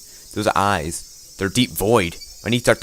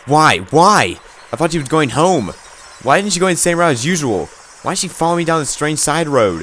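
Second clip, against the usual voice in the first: high, loud frightened voice; rising panic, voice pitched way up; panicked; 7 semitones higher, 4 dB louder than usual. Acted. Panicked inner questioning, fleeing.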